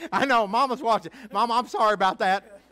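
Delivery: laughing loud and high through the words. Real, not acted. Preacher laughing to his watching mama, loud and amused.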